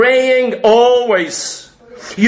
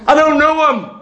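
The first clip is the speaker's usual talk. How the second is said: higher, insistent denial; Peter's denial; 5 semitones higher, faster than usual. Acted. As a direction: deny him flatly and hard. Peter denying Jesus.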